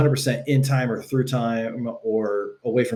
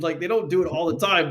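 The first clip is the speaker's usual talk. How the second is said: ordinary talk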